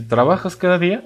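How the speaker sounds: "¿Trabajas cada día?" rising through "cada día"; asking, with a rise at the end; question intonation. Shown as a demonstration. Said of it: "esa pronunciación en forma de pregunta".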